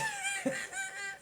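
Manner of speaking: high, squealing laugh; helpless high-pitched laughter; real laugh. Real, not acted. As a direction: crack up in a high wheeze. Cracks up at the result.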